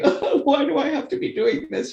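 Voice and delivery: high, put-upon whine after hours of work; mock whine. She complains to herself, worn out after ten hours, in a high mock whine.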